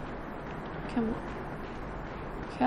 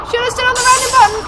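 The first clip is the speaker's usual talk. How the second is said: higher, louder, fast exasperated yell; frustrated shout; 10 semitones higher, 9 dB louder, much faster than usual. Real, not acted. Repeated, peak of rising frustration.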